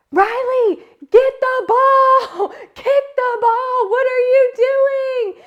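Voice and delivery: put-on high, loud, slowed yells at a kid on the field; retold sideline yelling. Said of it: "I am yelling on these sidelines".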